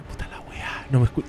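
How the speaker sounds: under-the-breath curse, whispered; muttered to himself. Retold or imitated. Locked out, muttering to himself under the breath. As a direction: mutter it to yourself, annoyed. Muttering frustration while waiting outside.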